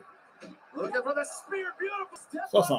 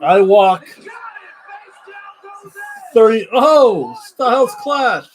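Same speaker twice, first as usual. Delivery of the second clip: excited; 9 semitones higher, 4 dB louder, slower than usual. Real, not acted. Stunned by a wrestling kickout.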